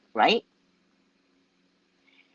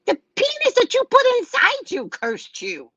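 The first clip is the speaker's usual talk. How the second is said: sassy outburst. Real, not acted. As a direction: fire back the punchline with sass. Sassy punchline to client.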